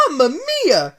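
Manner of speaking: high, loud Italian plumber cry; joyful Mario. Acted. Mario finds the game, loud joyful Italian cry. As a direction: cheer it like Mario overjoyed. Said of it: "with the uttermost joy".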